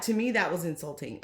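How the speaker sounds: ordinary delivery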